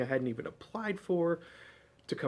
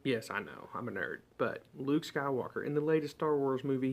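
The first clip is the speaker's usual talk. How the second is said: usual speaking voice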